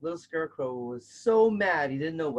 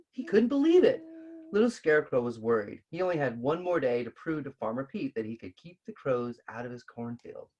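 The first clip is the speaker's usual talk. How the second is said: usual speaking voice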